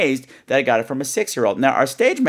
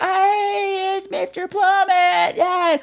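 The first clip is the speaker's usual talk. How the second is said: put-on much higher, slow, sing-song little girl; much slower than usual. Katie greets Mister Plummet, a high little-girl voice. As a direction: chirp it like a six-year-old girl. Voicing six-year-old Katie.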